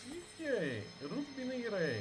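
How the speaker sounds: high, startled question; baffled, pitch jumping up; surprised. Real, not acted. Surprised at fleeing enemy. (हैरान होकर ऊँची आवाज़)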